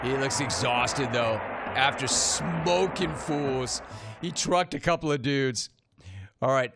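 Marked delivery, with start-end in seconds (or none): 1.8-3.8 SMOKING stretched out slowly; drawn-out emphasis. Real, not acted. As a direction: relish "smoking fools", dragging out "smoking". Relishing the phrase about the run.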